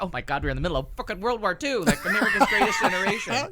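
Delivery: laughs it out in disbelief; laughing disbelief. Real, not acted. Laughing incredulous exaggeration.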